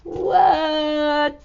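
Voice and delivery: plain reading voice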